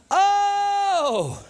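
long, high-pitched "Oh!"; David's exclamation. Acted. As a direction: hold a high exclamation, then let it fall. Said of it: "he just had a moment where he just went".

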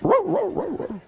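high put-on dog bark; mock barking. Preacher playing the revived dog, high yelping bark.

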